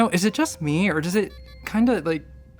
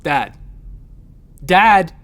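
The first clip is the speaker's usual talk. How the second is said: calling out; 10 dB louder than usual. Acted. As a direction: shout for your father. Calling after lost transmission.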